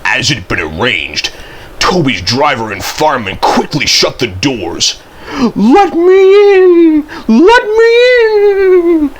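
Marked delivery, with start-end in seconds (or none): 5.5-9.1 high, loud ghostly wail; spooky voice. A narrator's reading. Percy plays ghost at the shed doors, spooky high wail. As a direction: wail it slowly like a ghost, drawing out the end. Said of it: "said Percy in a spooky voice".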